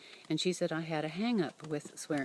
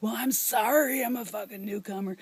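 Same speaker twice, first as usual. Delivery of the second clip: retold angry retort; 3 semitones higher than usual. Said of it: "so angry that I responded with".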